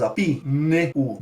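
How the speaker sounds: pneu broken into pi, ne, u; sounds split apart. Shown as a demonstration. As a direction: say each sound of pneu separately. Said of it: "elas são pronunciadas".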